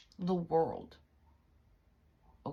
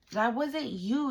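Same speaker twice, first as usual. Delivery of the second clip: normal talking voice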